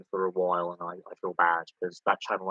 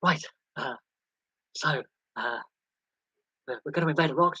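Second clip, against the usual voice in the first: higher, casual mock prime minister; breezy hint of Tony Blair; Tony Blair impression; 5 semitones higher than usual. Streamer mocking a prime minister announcing war, breezy and higher. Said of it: "And Tony Blair's like".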